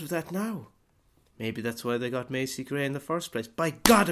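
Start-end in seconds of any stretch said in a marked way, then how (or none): 3.5-4.1 blurted out very loud; sudden eureka. Pretend. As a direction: shout it like you just cracked the case. Eureka moment, conspiracy cracked.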